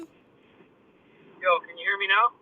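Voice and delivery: muffly phone line; distant speakerphone sound. Real, not acted. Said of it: "like, muffly".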